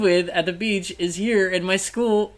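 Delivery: high, loud, overexcited gush; giddy excited gushing. Retold or imitated. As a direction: gush like a starstruck teen boy.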